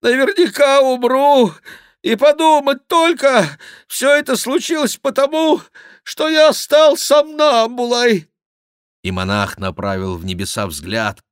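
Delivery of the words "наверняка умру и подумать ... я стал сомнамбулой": high, loud lament in bursts; wailing monk. A narrator's reading. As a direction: wail loudly at a high pitch. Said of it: "лепетал Гранфло". (громкие высокие причитания монаха)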